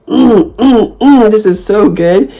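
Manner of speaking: high, slow, moaning delight; mock food rapture. Savoring food loudly to annoy people. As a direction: moan over the food. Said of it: "talking about it and saying".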